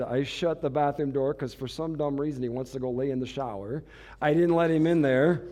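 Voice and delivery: usual speaking voice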